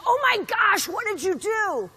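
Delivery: high, loud shocked cry, slowing on the question; shocked outcry. Retold or imitated. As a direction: gasp it out in disbelief, loud and high. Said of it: "And I said".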